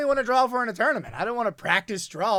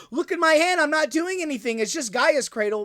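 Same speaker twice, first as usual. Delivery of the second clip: mock innocent; 7 semitones higher, 3 dB louder than usual. Mocking a Thrasios player's claim.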